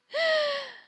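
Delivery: high embarrassed giggle. Real, not acted. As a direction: giggle at yourself. Laughs at own mishap.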